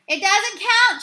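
high, loud protesting shout. A narrator's reading. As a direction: shout the objection. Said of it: "shouted the Saints manager".

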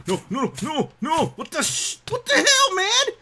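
very high, panicked exclamations; alarmed yelping. Real, not acted. Player hurt by bushes near a cow, alarmed yelping. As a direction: cry out in startled alarm.

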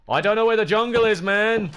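high, loud, rapid complaint; frustrated yell. Real, not acted. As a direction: burst out in exasperation. Frustrated at lost jungle.

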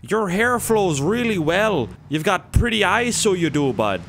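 high, sugary Canadian flattery voice; Canadian accent. Pretend. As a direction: gush compliments in a high, syrupy Canadian voice. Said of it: "It'd be like".

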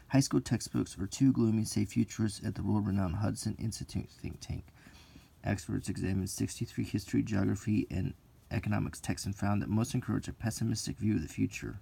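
punchy tabloid reading voice; dramatic headline read. Acted. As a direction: read it like breaking news. Reads tabloid article aloud.

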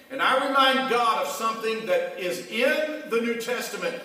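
usual speaking voice

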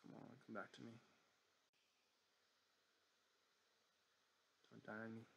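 soft, weary coaxing under the breath; quiet pleading. Real, not acted. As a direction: plead softly with a stalled machine. Coaxing the frozen computer along.

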